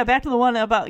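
ordinary talk, nothing marked

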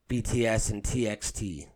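normal talking voice

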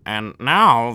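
hammed-up bad French accent; a clumsy Tom Hanks French accent; mock French general announcing. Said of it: "I want him to be like".

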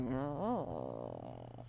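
low, quiet drawn-out "oh"; long low mock murmur; imitated group reaction. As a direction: imitate a room's awkward, sinking groan. Imitates the meeting's stunned reaction.

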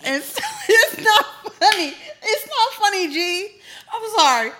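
high, loud giggly protest; trying not to laugh; laughing while protesting. Real, not acted. Glasses delay, laughing while protesting.

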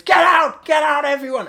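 loud high bellow of get out; John Hurt shouting. Retold or imitated. Friend imitating John Hurt screaming get out. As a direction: hurl it out like a screaming man. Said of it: "the bit where John Hurt goes".

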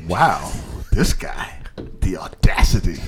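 hushed, scandalized whisper; mock gasp. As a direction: whisper like you're clutching your pearls. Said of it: "clutching my pearls".

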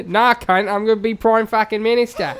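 loud, defiant mock New Zealander; New Zealand accent. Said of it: "say it in a New Zealand accent".

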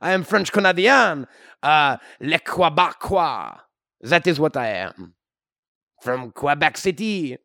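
put-on comic French-Canadian accent; hammy Québécois hero voice. French-Canadian Wolverine character.

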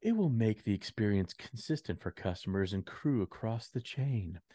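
smooth corporate announcement voice; marketing voice. Shown as a demonstration. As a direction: read it like polished PR copy. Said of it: "in the marketing voice that it was written in".

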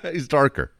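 laughing. Real, not acted. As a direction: answer while still laughing. Laughs at the co-host's question.

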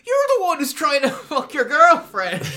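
loud, high, laughing indignation; laughing outrage. Real, not acted. Co-host ranting at the brother, loud and laughing. Laughing rant about the character.

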